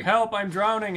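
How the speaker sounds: drowning. Pretend. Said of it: "just like".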